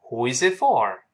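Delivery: measured, linked "Who is it for"; follow-me model reading. Shown as a demonstration. Teacher has students read after, clear linked model. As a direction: read it clearly, leaving space to echo. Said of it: "老师读完跟着读".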